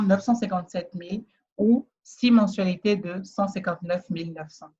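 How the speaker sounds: ordinary delivery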